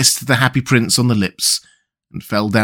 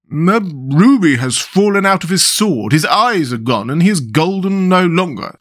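lightly voiced self-important mayor, higher; pompous mayor; 5 semitones higher than usual. A narrator's reading. Mayor inspecting the statue, lightly pompous. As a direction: complain like a puffed-up official. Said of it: "said the mayor".

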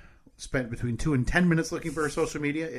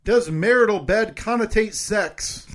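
slow, higher emphatic host read; game show host; 8 semitones higher, 7 dB louder, slower than usual. Acted. Reads poll questions.

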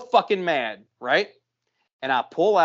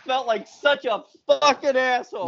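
loud, high, drawn-out wail of regret; anguished outburst; 8 semitones higher, 5 dB louder, slower than usual. Real, not acted. Storyteller confessing shame over his behaviour, loud anguished outburst. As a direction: cry it out slowly, pitched way up.

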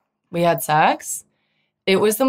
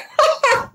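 high laugh burst. Real, not acted. Laughing after confessing to listeners. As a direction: laugh at your own secret.

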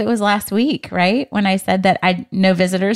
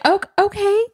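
much higher, light imitated "Oh, okay"; mock surprised reply; 11 semitones higher than usual. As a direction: imitate her caught off guard, pitched up. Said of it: "And she was like".

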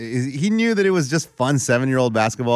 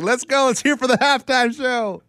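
mock announcer ref; 10 semitones higher than usual. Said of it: "the ref's like".

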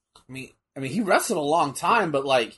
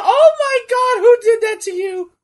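much higher, loud horrified mother's gasp; shocked mom; 8 dB louder than usual. Retold or imitated. Mother sees son's swollen chest, high loud horrified cry. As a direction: play the mother seeing bruises, shrieking in alarm.

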